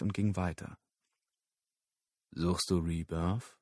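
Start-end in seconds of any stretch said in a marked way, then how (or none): 2.3-3.6 low, slow dealer murmur; deep voice. A narrator's reading. Dealer sounding Lyle out, low and slow. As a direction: drop low and slow, testing him. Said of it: "Es war eine tiefe Stimme".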